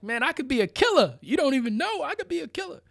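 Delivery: high, quick, rising disbelief; comic high-pitched warning; mock incredulous protest. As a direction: blurt it like a baffled guest. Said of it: "I'm like".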